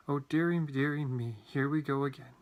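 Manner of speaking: plain reading voice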